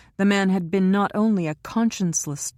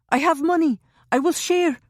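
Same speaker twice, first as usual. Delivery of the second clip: high, loud frantic pleading; 12 semitones higher, 5 dB louder than usual. A narrator's reading. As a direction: beg desperately for your life, much higher. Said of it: "he said frantically".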